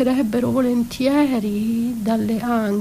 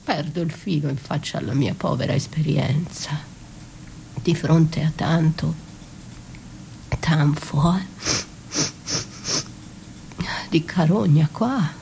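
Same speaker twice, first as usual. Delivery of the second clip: lower, hushed whisper, unsettled; 7 semitones lower, 5 dB quieter than usual. Acted. Confused by the stench of carrion, she whispers low. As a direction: whisper it in dismay. Disoriented, smelling decay.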